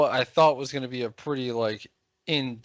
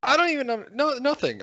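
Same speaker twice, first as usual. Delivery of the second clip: high mocking voice; 13 semitones higher, 3 dB louder than usual.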